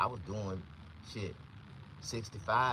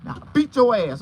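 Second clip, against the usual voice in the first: angry threat; 12 semitones higher, 11 dB louder than usual. Retold or imitated. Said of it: "He was like".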